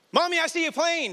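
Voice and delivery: put-on high little-boy voice; excited toddler calling out; child voice. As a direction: pipe it up like a small boy. Son voiced in retold story.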